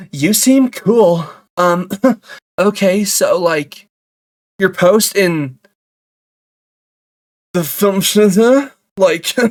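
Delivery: halting, drawn-out awkward flattery; put-on character. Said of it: "I can get in a character for this".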